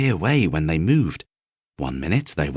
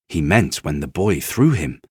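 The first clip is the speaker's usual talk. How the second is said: usual speaking voice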